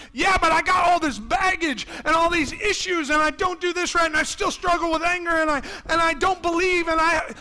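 loud, high, rushed excuses; pleading shout of excuses; protesting Peter. Pretend. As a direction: pour out every excuse at once.

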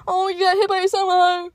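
playful sarcastic fussing; mock sympathy. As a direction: tease them in a put-on high coo. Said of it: "I'm just kidding".

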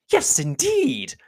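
very high, drawn-out indignation; high, slow, huffy exclamation; offended cry. A narrator's reading. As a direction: cry out as an affronted matron. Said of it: "offended by his manner".

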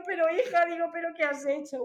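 high, loud mock reproach; acted exasperated alarm. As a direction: replay a mother's dismayed scolding. Madre riñe alarmada a su hija. (reproche alarmado de madre, imitado)

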